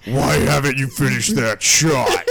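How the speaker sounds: slow, stern scary friend; stern, slow demand; serious and scary. Retold or imitated. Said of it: "She gets very serious and scary".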